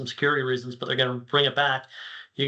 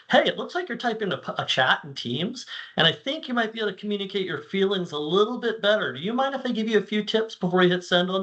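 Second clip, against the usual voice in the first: higher, sweet helper voice, a bit quick at the start; polite AI assistant; 6 semitones higher than usual. Retold or imitated. Speaker imitates an AI offering tips before a Teams message is sent, pitched higher. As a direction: mimic a chipper assistant gently butting in.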